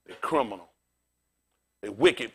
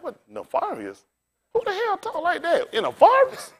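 rising in mock disbelief, climbing much higher; baffled at the fancy word, pitch shooting up; 11 semitones higher than usual. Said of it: "I'm like".

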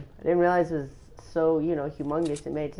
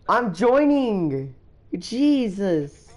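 usual speaking voice

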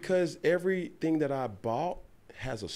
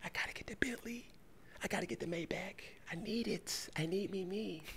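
greedy whisper; 6 semitones higher, 13 dB quieter than usual. Retold or imitated. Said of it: "I was like".

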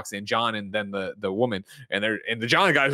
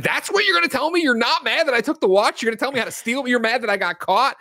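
put-on high, incredulous teen voice; indignant daughter; 12 semitones higher, 4 dB louder than usual. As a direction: play the outraged daughter throwing his words back. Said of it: "And she's like".

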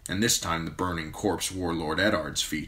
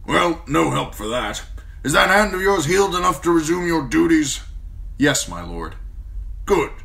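old commander voiced higher and louder, blunt and forceful; a gruff old commander praising a young man; gruff old lord commander; 10 semitones higher, 7 dB louder than usual. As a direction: speak as the stern old Lord Commander, grateful but brusque. Mormont orders.

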